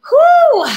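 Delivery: a big loud high whew of relief. Real, not acted. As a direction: blow out the effort with a loud whew. Breather after hard round.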